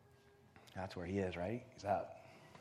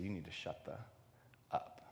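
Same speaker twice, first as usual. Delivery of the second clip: low, hushed warning with a weighted pause; dead serious; 6 semitones lower, 10 dB quieter than usual. Retold or imitated. Homeless man repeating his warning to her, dead serious. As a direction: hold back before the last word, deadly serious. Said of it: "he looked at her dead serious".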